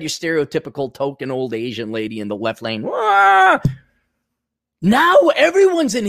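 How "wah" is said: mock startled squawk. Imitating an old lady driver.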